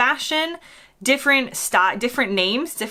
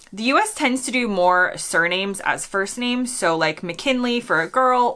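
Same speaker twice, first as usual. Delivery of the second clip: normal talking voice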